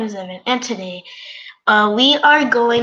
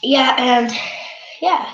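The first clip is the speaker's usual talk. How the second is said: usual speaking voice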